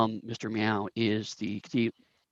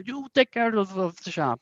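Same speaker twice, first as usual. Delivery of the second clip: high-pitched boss with a fake Korat accent; hammy Korat accent, pitched up; Mister Meow; 7 semitones higher than usual. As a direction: give orders in a bad Korat accent. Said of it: "very thick, um, Korat accent".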